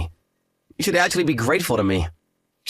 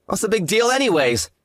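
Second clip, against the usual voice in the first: dismissive; 9 semitones higher than usual. Acted. Groom brushing off angry fiancée.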